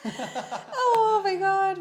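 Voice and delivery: high, loud helpless laughter; real laugh breaking through. Real, not acted. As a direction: crack up at the impression. Laughing at the Tyson impression.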